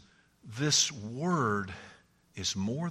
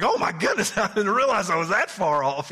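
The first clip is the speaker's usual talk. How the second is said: higher, louder and laughing; mock alarm; 8 semitones higher, 7 dB louder than usual. Preacher voicing a scared lost driver. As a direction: play the panic for laughs.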